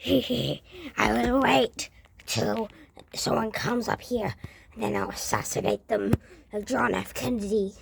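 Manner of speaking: low, hushed evil plotting; sinister whisper. Acted. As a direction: whisper the threat like a lurking villain. Villain plotting quietly.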